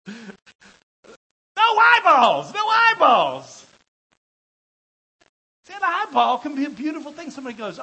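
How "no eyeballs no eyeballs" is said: very high, slow, loud wail; hammed-up howl of protest, vowels stretched; horrified refusal. Acts out revulsion at eyeball soup.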